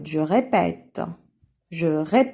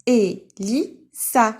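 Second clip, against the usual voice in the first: "Elissa" stretched very slowly, louder; slow, louder model of "Elissa"; slow syllabified name; 2 semitones higher, 3 dB louder, much slower than usual. Shown as a demonstration. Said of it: "teacher models name".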